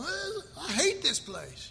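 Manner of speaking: laughing, mock-sulky complaint; mock grumble with a laugh. Pastor mocking bored church members, laughing grumble. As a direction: grumble it through a chuckle. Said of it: "sitting around going".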